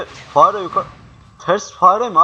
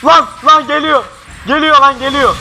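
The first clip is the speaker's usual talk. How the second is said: higher, louder frightened yelling; panicked; 7 semitones higher, 4 dB louder than usual. Real, not acted. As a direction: yell in real fright. Monster approaching again. (korkuyla bağırış)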